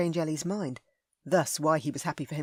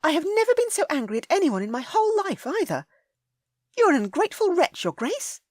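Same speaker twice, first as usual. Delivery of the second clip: high, loud indignant lady; Ellie, furious; 13 semitones higher, 6 dB louder than usual. A narrator's reading. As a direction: cut him off in a rage.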